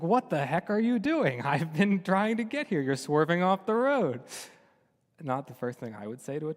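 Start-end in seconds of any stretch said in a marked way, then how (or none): 0.0-4.2 higher, louder exasperated yell with a laugh; angry shout. Retold or imitated. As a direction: snap at the donkey in mock outrage. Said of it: "He was like".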